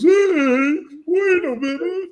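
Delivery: high squealing whiny voice. Retold or imitated. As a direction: whine it, mocking the exec. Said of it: "your representation of Marvel Comics".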